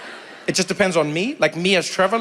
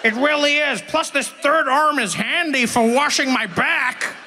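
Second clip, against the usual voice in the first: cheerful mutant; 7 semitones higher, slower than usual. Pretend. Joking about unnatural springs, goofy mutant voice. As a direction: play a happy toxic swimmer.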